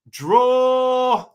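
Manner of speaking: a sudden high, loud "Draw."; emphatic call. Real, not acted. Streamer announces his pick to the chat, high and loud. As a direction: blurt the pick out like a verdict.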